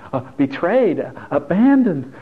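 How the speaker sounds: hammed-up, high, wounded cry; feminine boyfriend impression. Boyfriend failing at manly Dodgers talk, theatrically wounded. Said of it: "his very feminine boyfriend".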